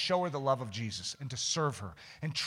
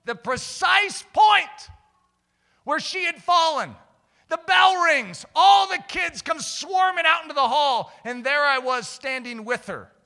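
high, loud, driving sermon voice; impassioned preaching; 11 semitones higher, 9 dB louder than usual. Real, not acted. Preacher warning the congregation about sin, impassioned. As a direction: press the warning home with force.

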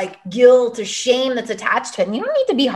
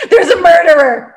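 higher, louder, hammed-up scared wail; mock fear; 8 semitones higher, 8 dB louder than usual. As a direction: fake terror at a noise. Joking about night noises.

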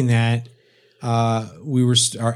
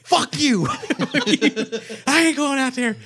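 much higher, laughing, petulant complaint; high whiny wounded man. Retold or imitated. Retelling a movie scene, friend mimics a whining half-frozen man. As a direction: whine like a man who barely survived the cold. Imitating a cold, wounded character.

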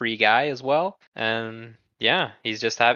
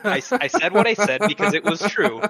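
laughing as he insists; 9 semitones higher, 3 dB louder than usual. Real, not acted. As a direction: giggle through the line. Laughing defence.

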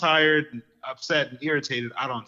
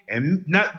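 ordinary talk, nothing marked

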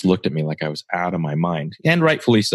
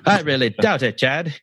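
high-pitched old-time mid-Atlantic put-on; mock transatlantic accent; 8 semitones higher than usual. Host answering Chad's copyright worry, mock transatlantic accent. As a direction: play at a transatlantic newsreel voice. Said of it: "that's mid-Atlantic or transatlantic accent".

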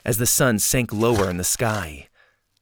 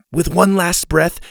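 plain reading voice

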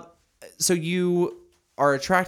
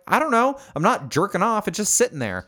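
high-pitched innocent protest; high defensive voice; 11 semitones higher, 3 dB louder than usual. Retold or imitated. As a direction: squeak it out, playing innocent. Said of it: "I'm like".

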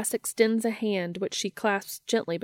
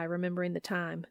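ordinary delivery

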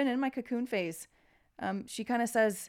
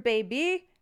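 ordinary delivery

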